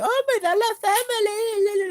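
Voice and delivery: very high, loud mocking wail; hammed-up shrieky imitation of a drama-seeker. Mimicking someone who wants to be famous, mock wail. Mocks a woman seeking fame.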